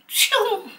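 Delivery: high-pitched imitated zoom sound; whoosh noise. Shown as a demonstration. Vlogger showing how to clear the road, high whoosh. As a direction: make a quick-getaway noise, high.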